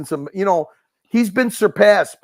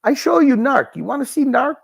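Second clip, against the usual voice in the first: higher, hammed-up Pakistani accent, eager; mock Pakistani accent; 10 semitones higher than usual. Mocking a streamer friend showing off his pet, Pakistani accent. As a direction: do a bad Pakistani accent offering to show. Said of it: "I can't do a Pakistani accent, but he goes".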